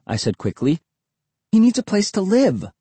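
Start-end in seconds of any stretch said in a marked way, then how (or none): 1.5-2.7 put-on pleading boy voice. Boy begging for the dog.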